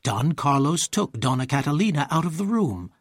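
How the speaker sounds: ordinary delivery, nothing marked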